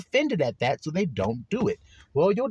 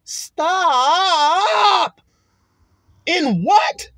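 shout; 11 semitones higher, 5 dB louder, much slower than usual. Real, not acted. Preacher halts the flock.

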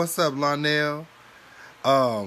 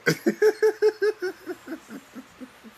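high loud burst of laughter; 5 dB louder than usual. Real, not acted. Laughing out loud at the dying aunt's eye blinks. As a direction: crack up loudly.

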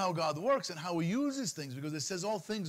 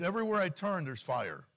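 ordinary talk

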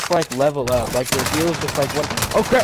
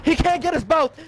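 excited shouting; 12 semitones higher than usual. Real, not acted. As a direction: scream encouragement at a teammate. Hyping final fight.

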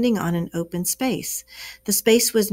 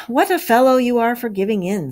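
usual speaking voice

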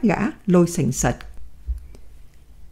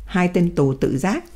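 usual speaking voice

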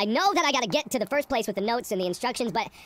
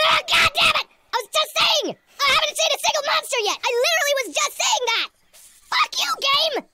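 high, loud angry yelling; frustrated yelling; 12 semitones higher, 4 dB louder than usual. Real, not acted. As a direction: rage at the game. Monster appears, he yells.

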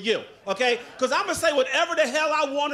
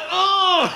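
mock grunt; 7 semitones higher, 9 dB louder than usual.

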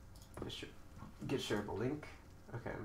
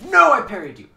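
angry yell; 9 semitones higher, 18 dB louder than usual. Real, not acted. Angry protest at opponent.

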